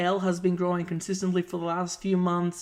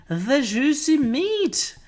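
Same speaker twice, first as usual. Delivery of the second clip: savoring; 8 semitones higher, 5 dB louder, slower than usual. Acted. Playful emphasis.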